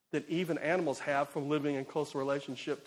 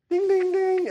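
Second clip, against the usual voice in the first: high, loud ringing bell imitation; imitated alarm bell; 13 semitones higher, 9 dB louder than usual. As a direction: sing out like a bell going off.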